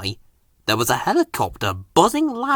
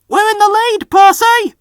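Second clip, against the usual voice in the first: much higher, louder triumphant shout; 7 dB louder than usual. Acted. Driver shouting to Percy, high and loud. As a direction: yell it over the engine. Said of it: "Percy's driver shouted".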